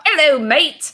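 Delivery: high, loud put-on English accent; chirpy mock English accent greeting a friend; bad English accent. Friend imitates the artist in an English accent. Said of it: "I think that was my English".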